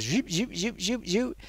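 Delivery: soft mimicked camera whooshes, fast; film reviewer mimics a camera swinging about with soft zoops; camera zoop noises.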